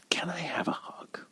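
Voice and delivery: very soft imitated whisper; retold whisper. Old woman asking a stranger for a hug, whispered. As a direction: whisper it like a timid request. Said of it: "very, very whispers to me".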